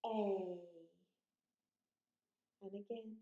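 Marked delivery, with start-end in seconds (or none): 0.0-0.6 A drawn out, gliding as it goes; long vowel A. Shown as a demonstration. Teacher shows children the long sound, A stretched out. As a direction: hold the letter name A long. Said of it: "You say it as we write it".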